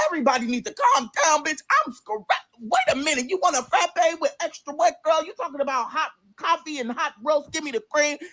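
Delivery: high, overwhelmed protest; frazzled outburst. Retold or imitated. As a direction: act out being swamped by orders, pitched high. Retold frazzled drive-through shift.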